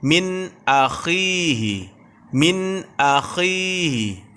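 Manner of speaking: min akhihi slow, n sharp with no nasal hold; clear recitation. Shown as a demonstration. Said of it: "kita baca jelas".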